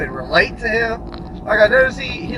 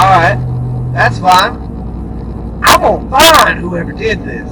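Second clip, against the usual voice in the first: threatening boss; 8 dB louder than usual. Retold or imitated. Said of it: "He's like".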